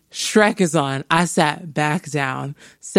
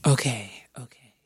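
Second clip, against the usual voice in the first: whispering; 4 semitones lower, 4 dB quieter than usual. Real, not acted. Whispered reaction to the list.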